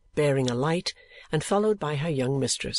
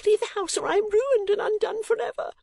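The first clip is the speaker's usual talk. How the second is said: put-on much higher pleading girl's voice; distraught young lady. A narrator's reading. Young lady begging Nicholas to leave, distraught. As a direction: beg him to flee, in distress. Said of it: "cried the young lady".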